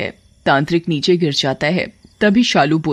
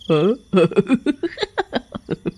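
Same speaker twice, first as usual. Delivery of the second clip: gloating witch laugh; drawn-out evil cackle; 3 semitones higher than usual. Acted. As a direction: cackle wickedly like a witch queen.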